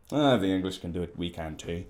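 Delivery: a lightly attempted Northern Irish accent, thrown off fast; mock Northern Irish accent, half-hearted; terrible Northern Irish accent. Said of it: "That was terrible Northern Irish accent".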